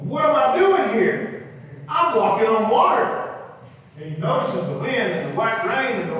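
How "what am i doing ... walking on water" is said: much higher and louder in disbelief; amazed Peter. Acted. Peter realizing he walks on water, high amazed exclamation. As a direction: marvel out loud at the impossible.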